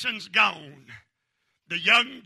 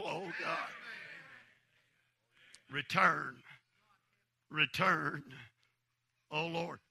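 hushed prayer; 3 dB quieter than usual. Acted. Preacher praying softly before the congregation, hushed pleading. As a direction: pray it quietly, almost broken.

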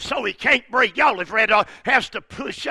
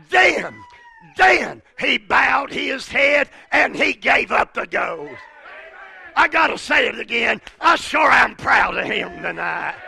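loud, high-pitched preaching at full force; 4 semitones higher, 3 dB louder than usual. Acted. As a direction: preach it out with rising fire.

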